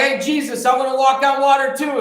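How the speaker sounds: lightly acted keen disciple; eager Peter. Acting out Peter calling to Jesus.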